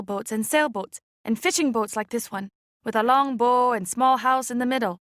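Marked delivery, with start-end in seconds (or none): none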